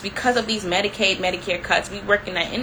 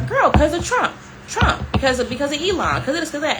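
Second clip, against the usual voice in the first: sassy explaining; 7 semitones higher than usual. Retold or imitated. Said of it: "And I'm like".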